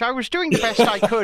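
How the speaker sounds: a hint of an Irish lilt; Irish accent. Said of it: "I'll do it in Irish".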